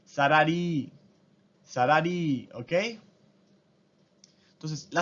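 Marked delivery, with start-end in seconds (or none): 0.0-0.9 sarari, both r's tapped; Japanese loanword. Shown as a demonstration. Teacher demonstrating salary in Japanese: サラリー. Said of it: "se convierte en". 1.6-2.5 sarari, both r's tapped; Japanese loanword. Shown as a demonstration. Teacher demonstrating salary in Japanese: サラリー. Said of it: "se convierte en".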